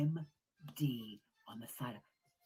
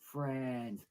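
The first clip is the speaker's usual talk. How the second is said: ordinary talk, nothing marked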